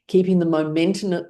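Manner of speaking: ordinary talk, nothing marked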